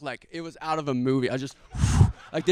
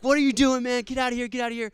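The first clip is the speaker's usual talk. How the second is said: retold yell; 8 semitones higher, 4 dB louder, faster than usual. Himself yelling at attacker.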